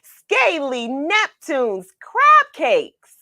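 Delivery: high, slow, delighted cry; excited exclamation. Acted. Hungry fish spotting crab cakes, high and thrilled. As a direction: relish the sight of food.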